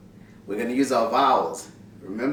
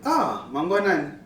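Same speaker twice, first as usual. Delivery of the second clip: Ah lifted high before mangwanani; happy Shona greeting back; enthusiastic reply; 6 semitones higher, 3 dB louder than usual. Shown as a demonstration. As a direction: answer with glad surprise. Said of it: "showing your excitement or your enthusiasm".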